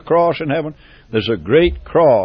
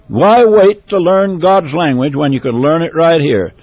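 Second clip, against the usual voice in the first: higher and louder rising preacher's cry; fervent climax of a sermon; preaching at full force; 4 semitones higher, 5 dB louder than usual. Acted. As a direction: call the churches out with fire. Fiery appeal to the congregation.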